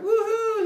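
loud, high "woohoo"; mock-triumphant high holler; screamed gleeful whoop. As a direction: whoop as if racing across the sky. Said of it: "Phaethon is screaming down to everyone".

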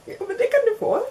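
high, bright phone-order voice; sing-song customer service; chirpy service voice. Retold or imitated.

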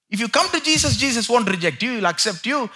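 ordinary delivery

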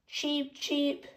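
high little cheeps; two high-pitched bird peeps; chick cheeps. Acted.

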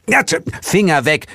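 startled, annoyed cry; called out. A narrator's reading. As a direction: shoo the hand away sharply. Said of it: "rief er".

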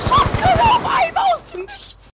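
high shocked cry; shocked outburst. Real, not acted. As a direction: squeal in disbelief. Shocked by surprise shotgun. (schockierter Aufschrei)

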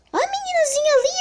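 high fast excited cry; excited shriek. Real, not acted. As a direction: squeal at spotting her. Spotting target in game.

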